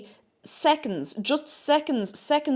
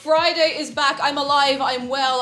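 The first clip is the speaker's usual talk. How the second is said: normal talking voice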